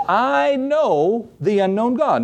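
high, drawn-out declaration, leaning on I KNOW; imitated apostle's emphatic boast; emphatic claim. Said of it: "This emphatic claim by Paul".

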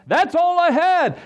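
pitched high and slowed, full of regret; anguished cry. Real, not acted. Preacher recalling his empty life, crying it out high and slow. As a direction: cry it out as if remembering the lowest point.

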